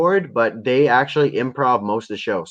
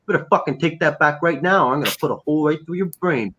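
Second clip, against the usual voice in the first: higher-pitched, acted gunpoint warning; mock threat; 4 semitones higher than usual. Fan imitating a dealer with his gun drawn, mock threat. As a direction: threaten in a put-on tough voice.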